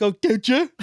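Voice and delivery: high, loud mocking echo; mock deaf guy voice. Answering the boss's kid in mocking deaf guy voice. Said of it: "I'm like".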